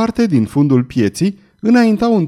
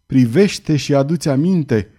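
usual speaking voice

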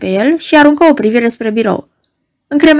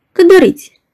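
curt, higher and louder snap; sec și scurt; 7 semitones higher, 5 dB louder than usual. A narrator's reading. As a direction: toss it off dryly. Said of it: "răspunse sec și scurt". (răspuns sec, mai sus și mai tare)

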